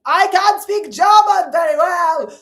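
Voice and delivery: put-on loud, slow, barked German accent; high, shouted comic German-accented English; hammed-up German accent at full volume; mock German accent. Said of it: "say English words in their accent".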